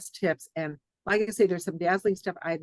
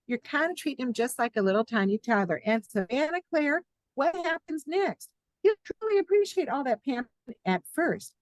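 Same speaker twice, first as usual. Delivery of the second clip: lively, higher Southern-tinged book reading; 6 semitones higher than usual. Acted. Author reading her marriage book to the host, raised and lively. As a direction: read it like a sassy advice column.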